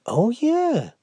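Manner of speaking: high, startled realization; suddenly notice the club; surprised dad. Acted.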